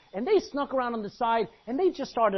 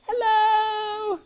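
high, loud sing-song hello; playful high greeting to the enemy; mock cheery call; 14 semitones higher, 6 dB louder than usual. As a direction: pop up and hail them brightly. Popping out of rocks, calling.

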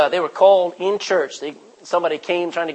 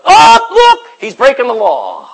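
shrill high tattling cry, much louder; mocking onlookers; 8 semitones higher, 15 dB louder, slower than usual. Onlookers tattling on Daniel with a high loud cry. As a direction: tattle on him like a scandalized crowd.

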